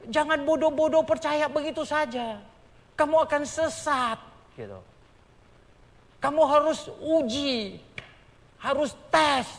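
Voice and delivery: loud, high pulpit warning; stern sermon admonition; preaching at full force. Acted. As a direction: warn the flock sternly.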